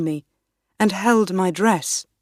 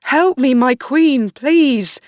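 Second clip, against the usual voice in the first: higher, louder, rising to a shriek; terrified screaming; 9 semitones higher, 6 dB louder than usual. Acted. Riccio pulled out of the room, screaming in terror. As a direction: beg for your life as they drag you. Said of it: "he screamed".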